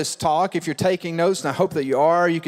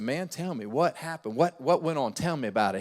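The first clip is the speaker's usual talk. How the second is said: soft, lower, caring voice; gentle pastor; 3 semitones lower, 7 dB quieter than usual. Retold or imitated. Pastor coaxing the upset teen, soft and caring. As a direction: coax him gently. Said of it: "I said".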